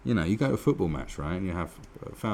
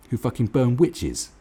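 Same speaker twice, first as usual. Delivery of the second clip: normal talking voice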